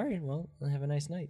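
acting out a meek sign-off; awkward polite goodbye. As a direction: say a tentative goodnight, softly.

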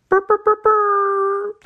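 high, loud, slow shivering brr; mock shiver brr. Host opening the February series, loud shivering brr. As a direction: chatter out a freezing brr.

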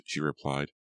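ordinary delivery, nothing marked

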